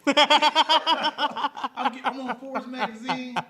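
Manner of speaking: loud, high laughing boast; laughing brag. Acted. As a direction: burst out laughing mid-brag. Mock-bragging father, big laugh.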